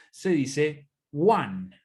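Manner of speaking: one pronounced like U A N, no G; English one. Shown as a demonstration. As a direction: say one as wan. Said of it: "Es como U A N". (pronunciación: one como "uan")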